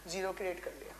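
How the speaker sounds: usual speaking voice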